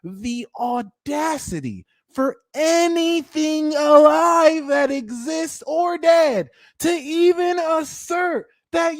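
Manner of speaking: high, drawn-out haughty indignation; be royally appalled at the very idea, hammed-up; audacious royal outrage. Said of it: "as if there is an audacity".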